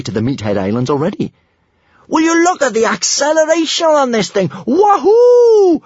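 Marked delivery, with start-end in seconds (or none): 2.1-5.8 high, loud thrilled shout; exhilarated high-pitched holler; whooping. A narrator's reading. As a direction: shout over roaring wind, ending in a whoop. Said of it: "whooped Kamikaze".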